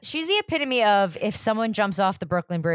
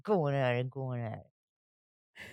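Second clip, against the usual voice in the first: slow, low and softer; fading slow mutter; 6 semitones lower, 6 dB quieter, much slower than usual. Acted. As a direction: let it trail off slowly. Repeated phrase, fading.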